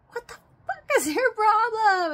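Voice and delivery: high, loud screamed demand; furious shout at a thief; yelling. Retold or imitated. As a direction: scream it at the intruder. Yelling at intruders in van.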